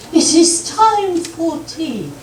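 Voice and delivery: high, slow put-on English accent; English dialect. Shown as a demonstration. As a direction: announce it in a stagey English accent. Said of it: "I can do an English dialect".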